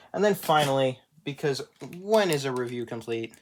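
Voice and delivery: normal talking voice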